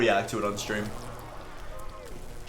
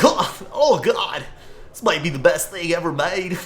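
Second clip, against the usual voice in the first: YouTuber voice; 6 semitones higher, 4 dB louder than usual. Retold or imitated. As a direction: stretch out the fake amazement. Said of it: "And they're like".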